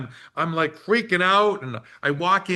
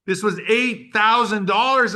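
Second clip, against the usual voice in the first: ordinary talk, nothing marked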